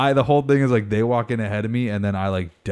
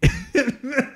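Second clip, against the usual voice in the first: high laugh. Real, not acted. Laughing at proposal plan.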